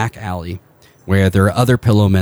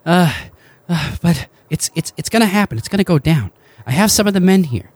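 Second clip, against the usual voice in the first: a higher, eager rebel leader; lightly voiced Joshua; 10 semitones higher than usual. GM as Joshua greeting the party, lightly higher. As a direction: pitch up a little, hopeful.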